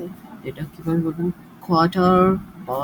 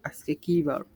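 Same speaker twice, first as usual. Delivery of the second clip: ordinary delivery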